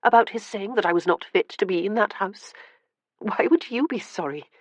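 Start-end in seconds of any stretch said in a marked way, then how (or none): 0.0-2.5 lightly echoing the insult, higher; a touch of wounded mockery; retold insult. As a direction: quote his slur with hurt irony. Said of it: "Viscount Uxbury calling me a doxy".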